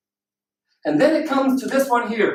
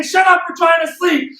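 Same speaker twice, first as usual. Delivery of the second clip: raised, higher mock shout; annoyed neighbours; 5 semitones higher, 4 dB louder than usual. People upstairs yelling at the noisy winner, annoyed. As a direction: holler a hammed-up complaint. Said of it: "people upstairs are saying".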